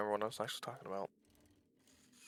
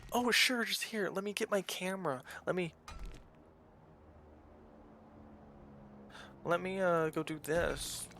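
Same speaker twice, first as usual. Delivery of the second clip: hammed-up sarcastic offer, much higher; mock sarcastic helper; 7 semitones higher than usual. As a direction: sneer it out like someone only helping for the camera. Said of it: "Or they'll be like".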